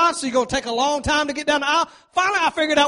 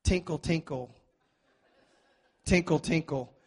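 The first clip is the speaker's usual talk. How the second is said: soft, lower sung tinkle like a harp; harp tinkle imitation; 7 semitones lower, 10 dB quieter than usual. Shown as a demonstration. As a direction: hum the tinkle of a harp quietly. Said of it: "It's like tinkle on a harp".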